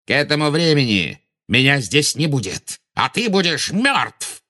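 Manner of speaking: high, venomous bark. A narrator's reading. Villain threatening his enemy with death, barking. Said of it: "рявкнул Волан-де-Морт".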